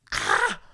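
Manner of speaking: sharp high cry of joy; excited yelp. Retold or imitated. Student reacting to the verdict in class with a yelp. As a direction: let out a sudden happy yelp. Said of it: "I remember I was like".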